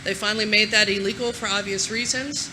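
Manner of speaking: ordinary talk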